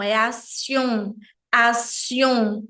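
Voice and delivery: action lento com final nasal francês; pronúncia francesa. Shown as a demonstration. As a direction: corrija para action devagar. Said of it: "Não, é action". (action à francesa)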